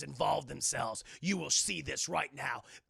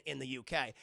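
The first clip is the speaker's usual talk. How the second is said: ordinary talk, nothing marked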